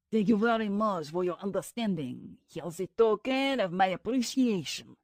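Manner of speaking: put-on a light French accent on a grateful man; thick French accent. A narrator's reading. Frenchman thanks the bribed officer, light French accent. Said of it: "The voice had a thick French accent".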